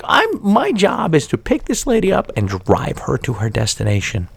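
ordinary talk, nothing marked

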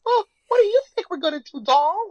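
high, loud mock Jersey girl; Jersey accent. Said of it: "Why she got a Jersey accent".

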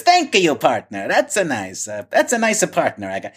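a quick, hammed-up Italian accent thanking a partner. Chico congratulating his bridge partner, light mock Italian accent. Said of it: "goes into the Italian accent".

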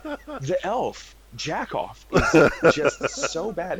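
put-on high-pitched little-boy voice; earnest small child, played by an amused parent; imitated young son. Six-year-old explains the elf to parents, imitated child voice. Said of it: "And he's like".

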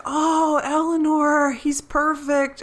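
high, drawn-out adoring coo; imitating Chidi. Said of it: "Chidi's like".

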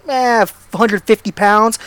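ordinary delivery

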